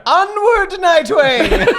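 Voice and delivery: high, loud heroic rallying shout; rally the charge, hammer held forward; Harley crying out to Nightwing, loud and high; battle cry. Pretend.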